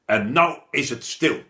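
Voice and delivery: loud, stern teacher roar; bellowing. A narrator's reading. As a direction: silence the room with a roar.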